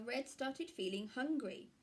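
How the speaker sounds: usual speaking voice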